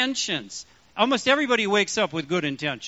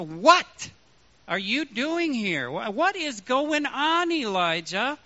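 slow, punched "What", higher pitch; stress on What; 5 semitones higher, slower than usual. Shown as a demonstration. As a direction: hit "What" hard, then slow down. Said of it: "voice inflection".

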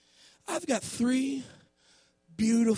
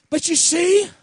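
loud, raised sermon delivery; full-force preaching; 5 semitones higher, 12 dB louder than usual. Real, not acted. Preacher likening God with Moses to a dad, loud full-force preaching. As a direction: drive the point home to the congregation.

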